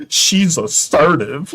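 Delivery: slow, drawn-out mock complaint. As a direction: stretch it out like a whiny guy. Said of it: "you're gonna be like".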